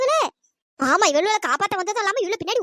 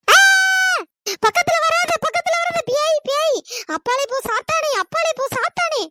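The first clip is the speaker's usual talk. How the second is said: panicked; 7 semitones higher, 6 dB louder than usual. Acted. Panicked, warding off a ghost.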